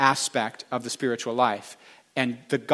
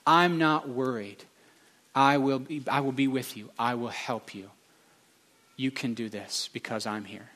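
reassuring voice of the Lord; 5 dB quieter than usual. Acted. Preacher voicing the Lord to the congregation, tender and reassuring. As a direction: gently voice a steady promise, easing down to quiet. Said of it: "Can't you hear him saying".

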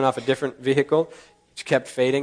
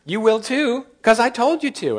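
much higher, mock-stern scolding; bossy parent voice; 9 semitones higher than usual. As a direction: play God as a nagging parent telling a kid off. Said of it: "that was the attitude".

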